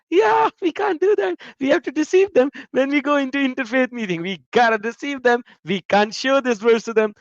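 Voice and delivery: high, scheming whispered-aside voice; mocking youngster voice. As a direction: play the sneaky young believers, high. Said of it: "they're laughing".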